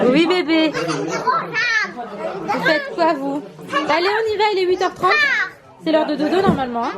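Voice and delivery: high, lilting bedtime-mum voice. Real, not acted. Mother getting kids to bed.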